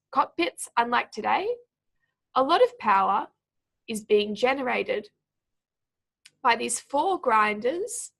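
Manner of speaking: measured demo reading, halting briefly at every clause break; pauses between clauses. Shown as a demonstration. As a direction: read aloud in short phrases, a pause after each. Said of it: "put them in for you".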